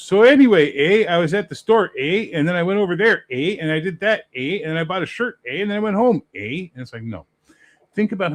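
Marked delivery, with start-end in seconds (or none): 0.0-6.7 much higher and louder, mocking a Canadian with eh on every line; mock Canadian accent. Mocking how Americans hear Canadians, a loud high Canadian accent. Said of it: "this is how a Canadian talks".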